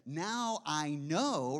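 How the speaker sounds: slow, drawn-out Now I know; slow realization. Retold or imitated. Preacher voices a believer realizing, slowly. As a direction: let the realization land slowly.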